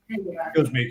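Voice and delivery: usual speaking voice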